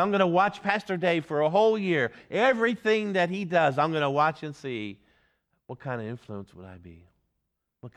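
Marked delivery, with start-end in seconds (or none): 0.0-5.0 higher, louder voice of a sceptical watcher; a lightly voiced doubter sizing up the preacher; imagined watcher. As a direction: play the suspicious neighbour keeping tabs on the pastor. Imagined churchgoer watching the pastor.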